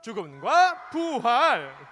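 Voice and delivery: slow, punched-out chant of the title; loud call-out. Real, not acted. Preacher leads children shouting the sermon title, loud call-out. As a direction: call it out slowly for all to follow. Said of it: "큰 목소리로 외쳐보도록 하겠습니다".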